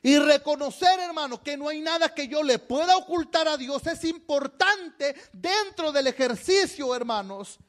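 high-pitched, insistent sermon voice; fervent preaching. Real, not acted. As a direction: press the point on the congregation. Preaches at full force.

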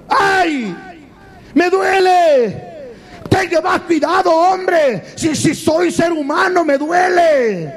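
high, hammed-up cry of pain; high-pitched acted wail of complaint; pained protest. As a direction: cry out in protest, drawing out the hurt. Acts out people's reaction to being molded.

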